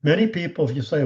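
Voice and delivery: normal talking voice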